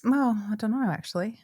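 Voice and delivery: ordinary talk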